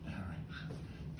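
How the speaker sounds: whispered, under the breath; whispered aside. Real, not acted. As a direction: whisper it to the bird. Aside to bird.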